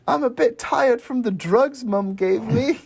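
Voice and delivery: put-on high, drawn-out little boy voice; slow, high mock child reply; Sam. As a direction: play the drugged kid telling on Mum, high and slow.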